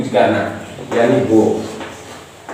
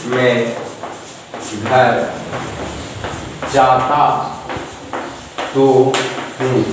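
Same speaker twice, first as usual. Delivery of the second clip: मैं घर जाता तो हूँ spaced out with long pauses, lower; word by word; 4 semitones lower, 4 dB quieter, much slower than usual. Shown as a demonstration. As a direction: spell it out one word at a time. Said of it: "जोर देके बोलिए".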